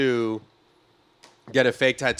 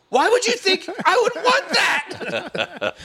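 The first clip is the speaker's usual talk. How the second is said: very high, slow and louder, laughing through it; slow high girly disbelief; 5 dB louder, slower than usual. Retold or imitated. Girlfriend asking her boyfriend why he'd want that, slow high squeal. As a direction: draw it out in a high shocked squeal.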